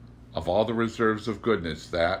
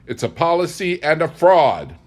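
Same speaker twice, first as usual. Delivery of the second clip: louder, higher, hammering fraud; heated accusation; 7 semitones higher, 9 dB louder than usual. A narrator's reading. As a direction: spit the charge with fierce certainty.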